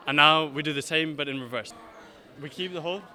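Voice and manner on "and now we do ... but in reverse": loud instructor call; calling out loudly. Shown as a demonstration. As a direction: call it across the room. Instructor calls next move loudly.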